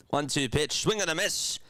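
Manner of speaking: live play-by-play. Real, not acted. Streamer calls Painter's third strikeout, in announcer play-by-play. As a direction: lift the call as the batter whiffs.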